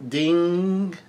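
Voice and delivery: high, held-out mock yell; Big Mom. Reader voicing a character's outburst, long high mock yell. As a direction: stretch it high without real volume. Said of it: "Big Mom yells out".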